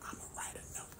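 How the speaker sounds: breathy voiceless whisper, fast at first; hushed mock whisper of a sick wife; imitating a whisper. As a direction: mimic the wife whispering through a sore throat. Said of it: "all this whispering".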